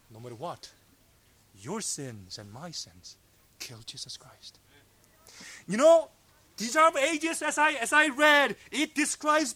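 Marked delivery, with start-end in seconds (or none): 0.1-4.6 very low, near-whispered verdict; hushed and solemn. Real, not acted. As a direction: drop right down to a grave hush. Preacher's hushed, solemn answer.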